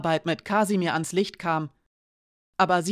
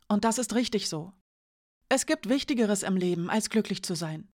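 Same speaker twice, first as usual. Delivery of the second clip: put-on higher, disbelieving young woman; Janka's voice; 4 semitones higher than usual. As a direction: voice Janka higher, shaken and accusing. Janka resigns herself.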